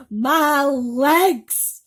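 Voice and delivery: high, very loud cry of alarm; anguished cry. Acted. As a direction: howl it in distress. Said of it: "he cried".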